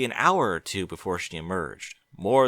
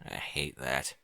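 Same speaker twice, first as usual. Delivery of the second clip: low, much softer grumble; grumbling; 8 semitones lower, 10 dB quieter, slower than usual. Acted. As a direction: complain under his breath. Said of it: "he grumbled".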